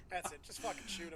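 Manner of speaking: ordinary talk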